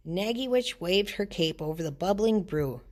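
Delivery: ordinary delivery, nothing marked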